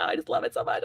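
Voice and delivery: breathless whisper of love for the film; whispered gush. Real, not acted. Telling her co-host how much she loves the film, whispering. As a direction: whisper it like a secret confession. Gushing about the movie.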